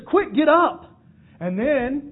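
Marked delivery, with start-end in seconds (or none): 0.1-0.8 loud, high urgent call; urgent angel. Acted. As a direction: jolt the groggy man awake. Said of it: "the angel says".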